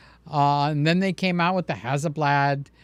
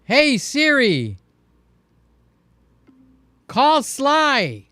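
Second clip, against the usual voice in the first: voice command to phone; 7 semitones higher, much slower than usual. Shown as a demonstration. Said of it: "You say".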